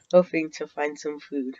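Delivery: ordinary delivery, nothing marked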